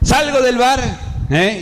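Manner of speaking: ordinary talk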